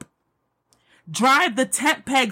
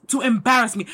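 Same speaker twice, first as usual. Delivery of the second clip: normal talking voice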